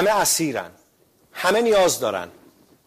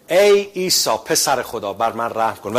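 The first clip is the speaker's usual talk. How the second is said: loud prayer quote; 5 dB louder than usual. Shown as a demonstration. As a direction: proclaim the prayer to the congregation. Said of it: "اون این بود که". (دعای بلند و شمرده)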